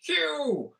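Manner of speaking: drawn-out mock film-star impression, part hushed; hammed-up Bond actor, swooping high on the exclamation; playful, slowed-down celebrity impersonation; Sean Connery. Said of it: "That's my impression of Sean Connery".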